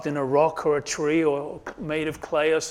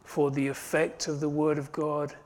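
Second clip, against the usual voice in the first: closing prayer; 4 dB quieter than usual. Acted. Pastor leading the congregation in closing prayer, hushed.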